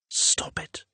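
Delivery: unvoiced whisper to oneself; hushed inner command; whispered self-rebuke. A narrator's reading. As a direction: whisper it sharply under your breath. Character scolds himself in thought.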